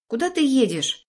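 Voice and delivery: put-on high uneasy boy; worried kid voice. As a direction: ask like a kid getting nervous. Nervous kid in car.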